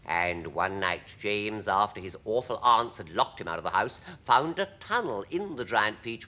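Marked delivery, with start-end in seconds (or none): none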